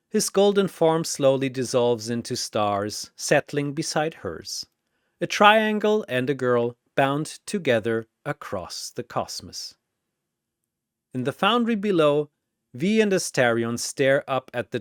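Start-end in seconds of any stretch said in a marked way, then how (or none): none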